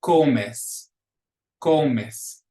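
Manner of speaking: comes in Spanish with CO- punched; stress on second-to-last syllable. Shown as a demonstration. Teacher showing the accent rule, Spanish comes stressed on CO-. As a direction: hit the first syllable of comes, let the second fall. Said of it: "second to the last syllable".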